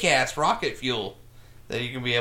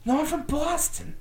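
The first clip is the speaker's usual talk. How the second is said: much higher, thick Boston "I'm from Boston"; Boston accent; 14 semitones higher than usual. Shown as a demonstration. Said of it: "That was pretty good".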